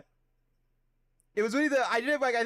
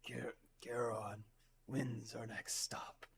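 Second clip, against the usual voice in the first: very slow, low, hushed voice; Vergilia; 7 semitones lower, 14 dB quieter, much slower than usual. Acted. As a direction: half-whisper it, drawn out and deep. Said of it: "since I've done a Vergilia's voice".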